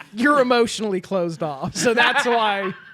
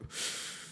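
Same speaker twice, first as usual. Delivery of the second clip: laboured mock sigh of a dad; heavy breath; 15 dB quieter than usual. Imitating dad before he answers son's affection, heavy breath. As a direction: push out a long weary breath. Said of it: "Just take a breather".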